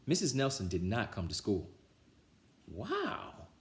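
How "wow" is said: put-on high excited kid voice; kids cheering. A narrator's reading. The kids cheer when the teacher is absent, high and thrilled. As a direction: squeal it with glee. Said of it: "yelled the kids".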